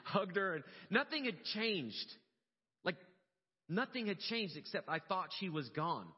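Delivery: high, loud, slow, emotional declaration; overcome with emotion. Real, not acted. Father recalling hugging the daughter he thought was gone, overcome. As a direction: push through welling emotion, words drawn out.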